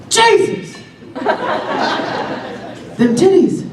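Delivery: high excited mock catcall; hammed-up street catcall. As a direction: yell it like a guy on the street.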